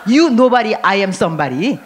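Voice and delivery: loud, pushy brag, punching nobody; hammed-up arrogant boast; mock boasting. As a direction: sneer it down at the crowd. Mocking a proud person.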